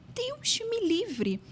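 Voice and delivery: high, slow, drawn-out exclamation of dread; mock horror. Real, not acted. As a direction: stretch it out like the idea appalls you. Horrified reaction to waking early.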